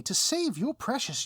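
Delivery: loud, high, exasperated villain restating the terms; hammed-up outrage on "save"; indignant villain. Villain repeats the terms to the hero, loud and exasperated.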